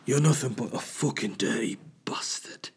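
raspy whispered menace; Game of Thrones voice. Shown as a demonstration. Showing off his Game of Thrones voice, whispered and menacing. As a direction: breathe the insult like a grim knight. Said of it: "any excuse to do my Game of Thrones voice".

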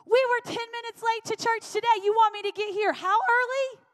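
hammed-up frantic complaint. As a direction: play the frazzled mom who can't believe it. Imagined overwhelmed parent protesting.

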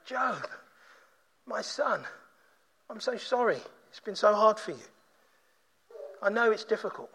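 soft, consoling fatherly voice; tender sympathy. Acted. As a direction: speak very softly, as if comforting a son. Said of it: "And he doesn't say".

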